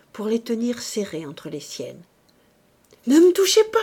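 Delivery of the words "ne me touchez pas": high, loud recoiling shout; frightened cry. A narrator's reading. Woman backs away from the priest's hands, crying out. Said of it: "cria-t-elle en reculant".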